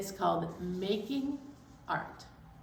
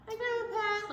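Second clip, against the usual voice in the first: high, squeaky puppet greeting; zebra puppet voice; 11 semitones higher than usual. Pretend. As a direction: play a cheerful zebra puppet saying hello. Puppet zebra greets Grandma Pat.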